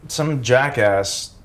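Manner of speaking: ordinary talk, nothing marked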